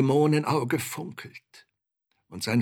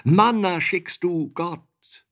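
high, loud, exalted cry; impassioned declamation, high and ringing; fervent calling out; 5 semitones higher, 5 dB louder than usual. A narrator's reading. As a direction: call it out with rising hope, like praise to heaven. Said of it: "mich erwärmend rief ich".

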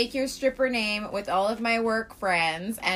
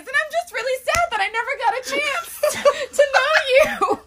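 much higher, laughing pleading voice; mock sad rep; 13 semitones higher than usual. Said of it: "literally what she's saying".

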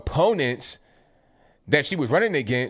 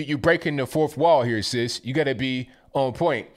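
ordinary delivery

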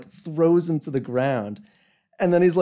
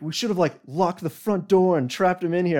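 higher, raised mock yell; guard's mock yell, pitched up; retold yelling; 7 semitones higher than usual. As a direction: imitate the guard yelling his plan. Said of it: "he was, like, yelling".

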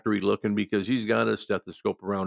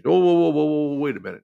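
indignant protest; 6 semitones higher, 7 dB louder than usual. Retold or imitated. Patient rejects CPAP.

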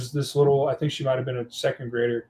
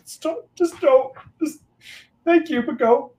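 choked up; 12 semitones higher than usual. Retold or imitated. Teacher thanking a second grader, high and choked up. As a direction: try not to cry while thanking her. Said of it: "And I was sitting there like".

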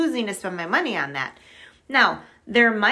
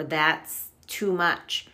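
drawn-out objection; 3 semitones lower, much slower than usual. Retold or imitated.

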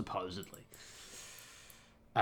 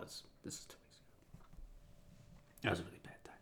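much softer whispered correction; whispered aside; 3 semitones lower, 17 dB quieter, faster than usual. Real, not acted. Clarifying the timeline to viewers, whispered aside.

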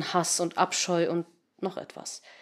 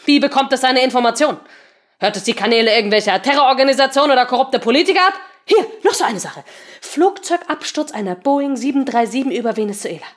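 angry; 6 semitones higher, 6 dB louder than usual. Acted. Seemann ranting in disgust.

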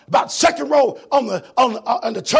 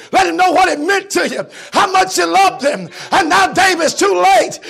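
rising from plain to high loud preaching; building preacher's appeal; 6 semitones higher, 7 dB louder than usual. Acted. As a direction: build it up phrase by phrase. Preacher building, rebuking David.

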